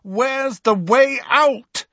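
high, slow, stretched-out cry; pleading yell, pitched high; desperate shout. A narrator's reading. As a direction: call out desperately, drawing each word out. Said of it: "he shouted desperately".

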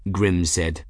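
usual speaking voice

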